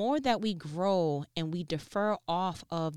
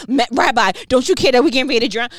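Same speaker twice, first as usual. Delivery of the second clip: loud, fast panicked shout; frantic disciples shouting over the storm; panicked cry; 7 semitones higher, 15 dB louder, much faster than usual. Acted. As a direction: yell it like the boat is sinking. Panicked disciples wake Jesus.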